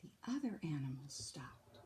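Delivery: whisper. A narrator's reading. Whispered narration.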